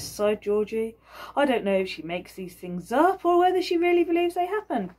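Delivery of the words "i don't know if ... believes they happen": higher, louder exasperated complaint; weary husband. Acted. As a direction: roll your eyes through it. Georgie complaining to the governor.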